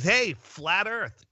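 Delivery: high, slow drawn-out teasing hail; greeting call. Retold or imitated. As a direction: call out across the market. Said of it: "She goes".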